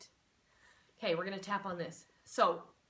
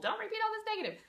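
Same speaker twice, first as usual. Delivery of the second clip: mock scolding voice; 13 semitones higher, 4 dB louder, faster than usual. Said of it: "we've been told".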